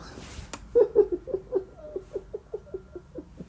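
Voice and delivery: real laugh breaking out high; squealing laughter; big high laugh. Real, not acted. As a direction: burst out in a high cackle. Laughing at the quote.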